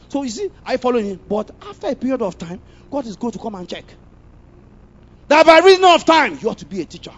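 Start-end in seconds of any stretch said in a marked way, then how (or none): 5.3-6.4 much higher, much louder emphatic cry; sudden full-force preaching shout. Real, not acted. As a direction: blast the phrase at the congregation.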